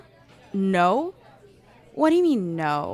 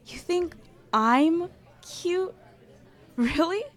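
excited; 7 semitones higher, 5 dB louder than usual. Acted. Delighted at compliment.

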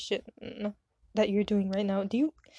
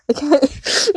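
cracking up; 5 semitones higher, 9 dB louder than usual. Real, not acted. Breaking character laughing.

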